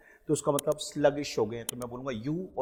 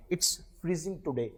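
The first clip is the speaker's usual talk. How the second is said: slow dictation; 4 semitones higher, much slower than usual. Shown as a demonstration. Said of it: "teacher models sentence for practice".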